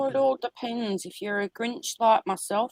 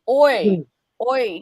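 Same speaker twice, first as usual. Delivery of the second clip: shouting Oi; 2 semitones lower, 7 dB louder than usual. Shown as a demonstration. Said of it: "Gets the rabbit's attention".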